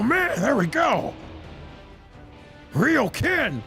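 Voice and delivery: hero's big drawn-out reactions; excited fighter, pitch leaping on the cries; in-character anime hero. Acted. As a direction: react as the fighter watching his attacks land.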